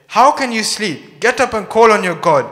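loud, high wake-up call; urgent rebuke. Acted. Captain to sleeping Jonah in the storm, urgent rebuke.

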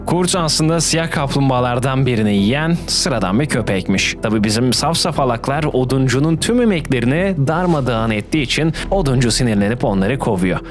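ordinary delivery

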